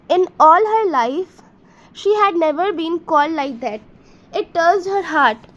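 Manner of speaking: normal talking voice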